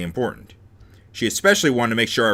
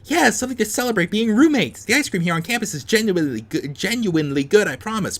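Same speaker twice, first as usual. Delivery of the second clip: high, upbeat persuading voice; eager roommate; 9 semitones higher than usual. Acted. Roommate pitching ice cream to Hana, high eager voice. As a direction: coax her in the high character voice.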